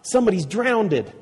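high-pitched eager announcement; excited exclamation. A narrator's reading. Tom explains the boom to his friends, excited. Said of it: "exclaimed Tom".

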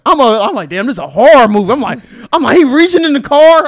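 loud, high-pitched incredulous rant; exasperated disbelief. Retold or imitated. Co-host retells watching the horror of the scene, loud exasperated disbelief. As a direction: rant it loudly, voice jumping up. Ranting retelling of movie reaction.

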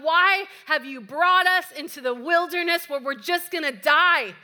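loud, high-pitched mock protest; acted complaining. Israelites protesting to God in the wilderness, acted loud complaining.

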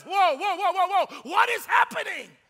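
loud, high alarmed cry; startled shout. Acted. The defeated enemy hears Jesus rise, shouting in alarm. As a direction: yell in sudden alarm.

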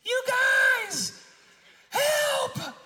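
high, drawn-out call from far behind; distant cry for help. Retold or imitated. Stuck hiker calling to friends ahead, shouting for help. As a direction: holler it high and long down the trail. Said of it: "and we hear this".

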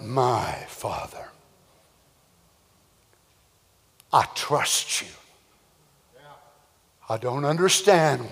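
soft, intimate, almost whispered trust; hushed pleading son. Acted. Isaac pleading softly with his father Abraham, hushed. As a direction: murmur it like a confused but trusting boy.

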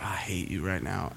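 mock grumble kept to an undertone; muttered resentment. Student to the friend who got him blamed, muttering.

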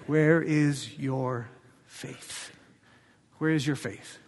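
a quiet searching question to frightened friends; hushed question. Acted. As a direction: ask it gently, almost under the breath. Jesus questions disciples after storm.

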